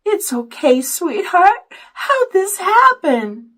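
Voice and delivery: high, wavering comfort; near tears. A narrator's reading. Mother comforts stuck son, close to tears. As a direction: soothe him while nearly crying. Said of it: "sounded like she was going to be crying".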